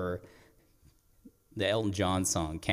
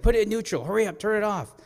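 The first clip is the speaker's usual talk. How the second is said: high, raised-voice orders; acted-out call to hurry; urgent yell; 11 semitones higher, 5 dB louder, faster than usual. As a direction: imitate frantically hurrying a learner. Grandfather shouting urgent orders.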